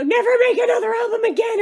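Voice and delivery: loud, high, fast rant; exasperated outburst. Retold or imitated. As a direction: blurt it out in exasperation. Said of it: "my exact quote was".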